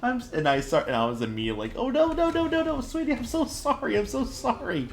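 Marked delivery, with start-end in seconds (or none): 1.8-4.9 much higher, sing-song sorry to the dog; oh no sweetie, high pet-talk; high-pitched apology to pet. Retold or imitated. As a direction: coo a frantic apology to a startled puppy.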